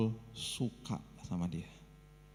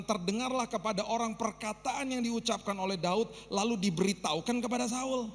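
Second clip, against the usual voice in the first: fervent proclamation; 12 semitones higher than usual. Shown as a demonstration. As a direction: proclaim it to the whole hall. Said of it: "Alkitab mengatakan".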